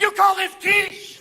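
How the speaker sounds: high, slow, snooty complaint; mock Martha Stewart voice; prissy Martha Stewart. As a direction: sniff at the food like a pampered diva. Martha Stewart in prison, mocked.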